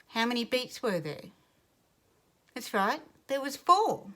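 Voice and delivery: ordinary talk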